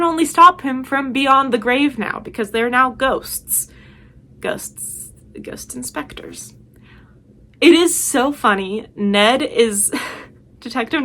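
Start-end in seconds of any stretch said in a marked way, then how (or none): 4.4-6.5 breathy ghostly whisper of the title; spooky whisper. Pretend. Whispering the show title to her viewers, spooky ghost whisper.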